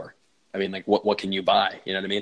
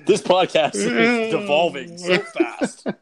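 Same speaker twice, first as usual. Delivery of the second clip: high, loud and drawn out through laughter; cracking up while lamenting; helpless laughing wail, slowed right down; laughing exclamation; 10 semitones higher, 6 dB louder, much slower than usual. Real, not acted. Cracking up at the bit.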